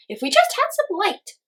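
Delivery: high, loud wistful chirp; high hummingbird. A narrator's reading. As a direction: sigh it in a tiny bird voice. Hummingbird voice continues.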